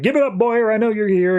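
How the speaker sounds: hammed-up superhero yell. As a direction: call out for him like a hero. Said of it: "he's just yelling out".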